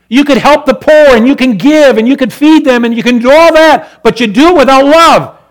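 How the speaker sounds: loud, high preacher's cry; loud gospel preaching; fiery preaching. Acted. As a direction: proclaim the commands to the congregation. Preaching climax.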